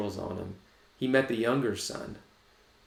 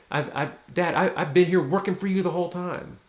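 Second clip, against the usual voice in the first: higher, louder whining complaint; aggrieved protest; 7 semitones higher, 4 dB louder than usual. Acted. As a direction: plead your case to Dad, wounded. Older son protesting to father.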